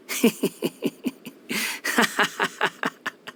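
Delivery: airy, high, breathy scornful laugh; mocking laugh. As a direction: laugh it off with contempt. Scoffs at the traveler's news.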